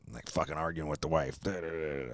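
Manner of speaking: ordinary delivery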